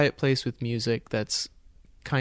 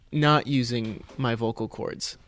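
normal talking voice

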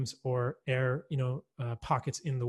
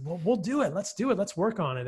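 lightly imitated Georgia accent, pitched higher; a friendly farmer's light Georgia accent, higher up; 6 semitones higher than usual. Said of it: "you know, in a Georgia accent".

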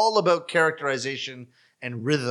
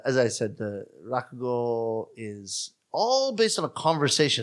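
usual speaking voice

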